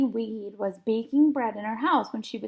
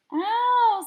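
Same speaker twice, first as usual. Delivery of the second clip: high startled ow; sudden high yelp; yelp of pain; 11 semitones higher, 3 dB louder than usual. A narrator's reading. Emily gets poked.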